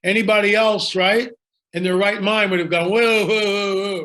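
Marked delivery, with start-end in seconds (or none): 2.9-4.0 mock alarm. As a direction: run the whoas together in pretend alarm. Acting anyone's alarmed reaction.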